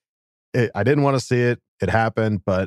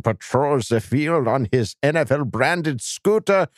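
high-pitched, hammed-up German accent; 5 semitones higher than usual. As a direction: read it grandly as a mock German. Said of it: "requested that I use my German voice".

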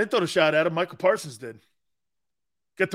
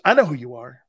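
louder, blunt brush-off from the coach; gruff coach; 8 dB louder, faster than usual. Retold or imitated. Coach Ryan brushing off the new player, loud and curt. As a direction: cut him off with a cold, loud greeting.

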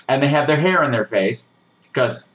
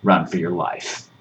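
mock stern daddy; 9 semitones lower than usual. Telling viewers to flee, low hushed mock warning. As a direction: drop down low like a spooky stern parent.

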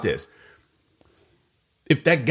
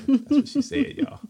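playful high tease. Real, not acted. Teasing the wife in front of audience.

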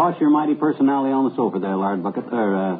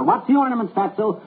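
mock holler; 8 semitones higher than usual. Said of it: "I'll holler".

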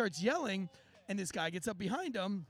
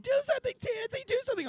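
very high-pitched mock panic; frantic plea; faster than usual. Bystanders begging him to join the brawl, mock frantic plea. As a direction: imitate pleading bystanders far up in pitch. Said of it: "Everybody was like".

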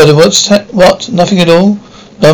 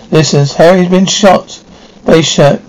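plain reading voice

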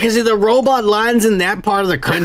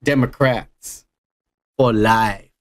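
quoted character; 4 semitones lower, slower than usual. Retold or imitated. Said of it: "And he's like".

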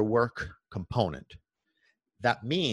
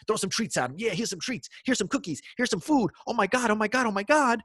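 high, gushing voice handing out treats; doting owner; 10 semitones higher than usual. Retold or imitated. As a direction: coo like an owner spoiling the dog. Owner spoiling dog with treats.

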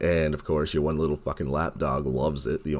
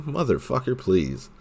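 scornful; 8 semitones higher than usual. Real, not acted. Scornful outburst at the liar.

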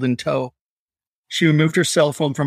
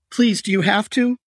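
high, desperate plea; begging; 8 semitones higher than usual. Acted. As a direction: beg through fear. Victim begs with knife at throat.

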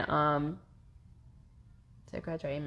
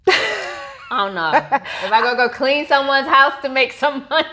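higher, louder, laughing joke; 8 semitones higher, 9 dB louder than usual. Real, not acted. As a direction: giggle through it. Laughing at her own joke.

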